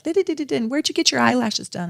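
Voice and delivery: high, giddy gossip voice, mocking; chatty yoga gossips. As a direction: ape two chattering busy bees, high-pitched. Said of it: "and they're like".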